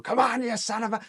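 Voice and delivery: high-pitched gruff-trainer impression; Mickey. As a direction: goad the fighter like a tough old-school trainer. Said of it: "the Mickey training".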